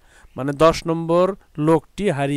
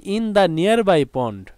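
very slow and a little higher; slow model sentence; 4 semitones higher, much slower than usual. Shown as a demonstration. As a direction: dictate the phrase slowly to the class. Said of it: "example sentence দেখি".

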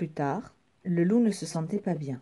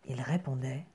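ordinary delivery

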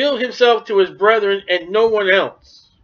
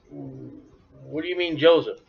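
stunned and hushed; 7 semitones lower, 6 dB quieter, slower than usual. Acted. Brothers stunned at the reveal.

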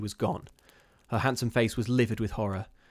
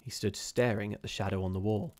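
ordinary delivery, nothing marked

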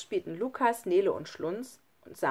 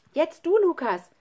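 high, loud prompt to a friend; calling out; 9 semitones higher, 8 dB louder than usual. A narrator's reading. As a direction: call down to cheer the next one on. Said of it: "rief Schlunz". (laut auffordernd gerufen)

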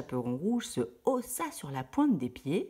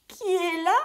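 put-on much higher, louder old granny call; old grandmother voice; 14 semitones higher, 6 dB louder than usual. Grand-mère demande à la porte. (grand-mère appelle à travers la porte)

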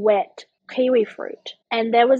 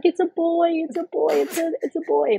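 higher, overcome retold exclamation; imitating tearful husband; 6 semitones higher than usual. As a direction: lightly act out an emotional new father. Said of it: "he's just crying and he's like".

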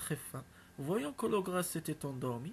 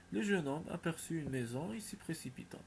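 plain reading voice

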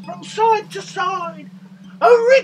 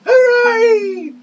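presenter leading a cheer; 3 semitones higher, 6 dB louder than usual. Shown as a demonstration. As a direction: lead the kids in a cheer. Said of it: "give 'em all a cheer".

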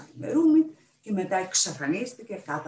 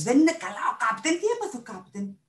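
startled alarm; 7 semitones higher, 4 dB louder than usual. A narrator's reading. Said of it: "τινάχτηκε σαν να τον τσίμπησαν τσούχτρες".

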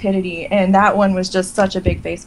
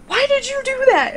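much higher, baffled mock husband; husband's baffled question. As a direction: imitate the husband asking in disbelief. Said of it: "He's like".

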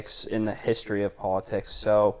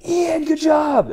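loud, very high, drawn-out shout; very projective voice; 6 dB louder, slower than usual. Retold or imitated. Legislative director congratulates the intern, hugely projected mock shout. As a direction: imitate a boss shouting praise across the office. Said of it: "has a very projective voice".

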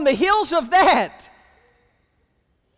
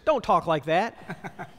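laughing mock rebuke; 7 semitones lower, slower than usual. Peter rebuking Jesus, retold.

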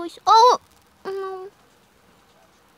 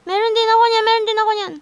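excited; 7 semitones higher, 9 dB louder, faster than usual. Real, not acted. Excited in game.

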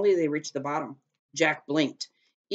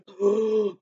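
sharp gasp of fright; fearful gasp; 5 semitones higher, 3 dB louder than usual. A narrator's reading. Jack sees the Dragon King glaring, fearful gasp. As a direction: gasp at sudden danger. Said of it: "He gasped".

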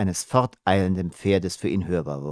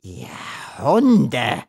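screeched insult; 3 semitones higher than usual. A narrator's reading. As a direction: screech it at your enemies. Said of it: "kreischte er".

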